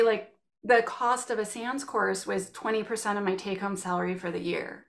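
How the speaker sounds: usual speaking voice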